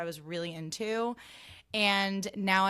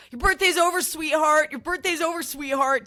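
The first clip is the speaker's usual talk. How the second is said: much higher, much louder mocking sneer; Jax impression; 10 semitones higher, 13 dB louder than usual. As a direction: lord it over her, loud and high. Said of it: "episode of Vanderpump Rules where Jax is like".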